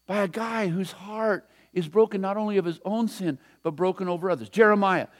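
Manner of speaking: ordinary talk, nothing marked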